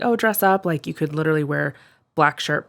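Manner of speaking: normal talking voice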